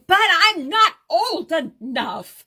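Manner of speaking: retold indignant protest, loud and slow; mock protest. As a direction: drag the words out in mock outrage. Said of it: "And I'm standing there going".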